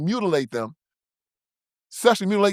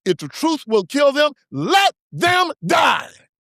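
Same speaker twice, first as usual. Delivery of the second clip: dramatic declaration; 6 semitones higher, slower than usual. Acted. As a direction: hammer home the final words very slowly. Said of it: "like Immanuel Kant says".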